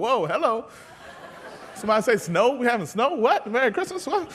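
startled, amused double take; startled dude. Retold or imitated. Pastor imitating a churchgoer, startled and puzzled. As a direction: play a guy jolted awake by a strange word. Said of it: "He's like".

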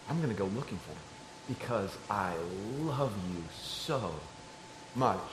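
a father's hushed declaration of love; tender reassurance. Retold or imitated. Father assures daughter of love.

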